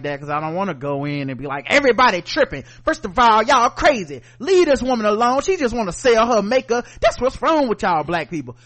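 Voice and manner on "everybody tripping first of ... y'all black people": loud, high-pitched mock tirade; revved-up rant. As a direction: act out going in hard on everybody. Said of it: "you get all revved up".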